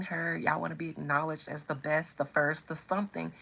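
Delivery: usual speaking voice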